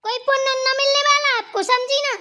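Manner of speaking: high, forceful rebuke. Acted. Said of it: "एक minute शांत रहो".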